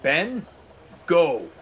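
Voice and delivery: stern dismissal. A narrator's reading. Railway boss sends the twins away, stern. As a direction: order them off with firm pauses.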